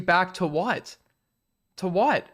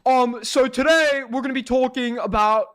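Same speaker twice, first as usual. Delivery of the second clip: a clumsy put-on Chud accent, a bit higher and louder; bad Chud accent; 5 semitones higher, 3 dB louder than usual. Streamer mocking drama channels in a bad Chud accent. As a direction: ham up a Chud drama-channel intro badly. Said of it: "that's not a good Chud accent".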